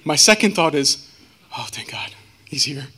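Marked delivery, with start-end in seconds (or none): 1.6-2.9 hushed, whispered aside of relief. Retold or imitated. Man retelling his second thought to listeners, relieved whisper.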